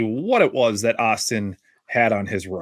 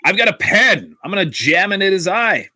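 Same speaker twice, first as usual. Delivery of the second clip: higher, louder tough-guy boast; pretend boast; 7 semitones higher, 4 dB louder than usual. Host imagining a guest's weapon, a loud mock-tough boast. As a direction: sell it like an action hero. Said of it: "or like".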